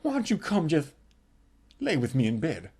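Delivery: soft, low seductive coo; sultry murmur. Retold or imitated. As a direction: coax him into bed, softly. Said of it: "And she goes".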